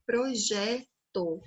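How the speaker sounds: projeto slowly, JE held before a separate "to"; stress on JE. Shown as a demonstration. Teacher repeating for children, projeto slow with a long stressed JE. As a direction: say pro-JE, then "to", drawn out. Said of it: "É a sílaba tônica".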